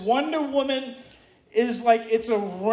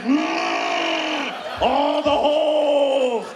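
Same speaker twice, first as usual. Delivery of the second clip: hulking out, each word a drawn-out roar; 2 semitones higher, much slower than usual. Retold or imitated. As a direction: growl and bellow in crude monster grunts. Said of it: "It's like I'm hulking out".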